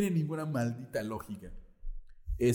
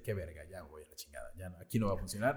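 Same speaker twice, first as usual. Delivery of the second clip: giving-up Satan mutter; 6 semitones lower, 5 dB quieter, faster than usual. Acted. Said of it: "Y Satanás dice".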